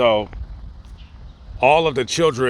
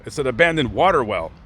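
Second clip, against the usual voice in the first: ordinary talk, nothing marked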